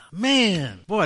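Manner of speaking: usual speaking voice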